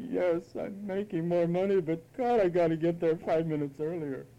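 put-on complaining whine; grudging, hammed-up moan; mock whining. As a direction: whine about a blessing. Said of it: "they whine about 'em".

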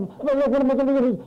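hurried fretting whine; imitating a frantic voice. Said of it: "You even hear it in their".

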